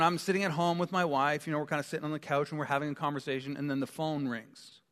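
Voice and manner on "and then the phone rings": usual speaking voice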